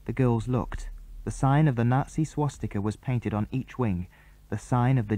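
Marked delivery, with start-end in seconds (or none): none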